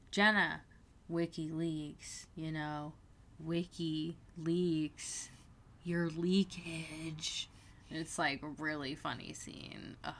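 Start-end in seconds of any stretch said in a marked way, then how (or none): 0.1-7.5 slow, drawn-out hinting; pointed hint. Retold or imitated. Dave hinting to Jenna in class about her leakage, slow and pointed. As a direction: spell it out slowly, as a coded hint.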